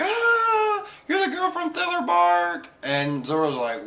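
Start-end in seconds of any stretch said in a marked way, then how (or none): 0.0-2.7 high-pitched gasp of recognition; imitating a shocked character in a very high voice; mock surprised outburst. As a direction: act out a startled, squeaky discovery. Acted surprised recognition in story.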